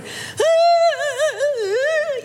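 high unhinged wailing; madwoman wail. Retold or imitated. Wife in the kitchen wailing, high and unhinged. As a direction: wail like the woman hidden in the attic. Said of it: "like the woman you hide in the attic".

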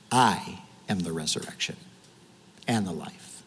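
softly, gravely, as Jesus to a grieving sister; hushed solemn words. Acted. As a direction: let it sink in quietly, like a sacred truth. Jesus speaking to Martha.

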